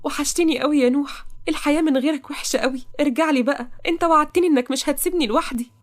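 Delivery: high-pitched, tearful longing. Acted. Toqa at unconscious Nouh's bedside, tearful pleading. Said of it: "تقى بدموع".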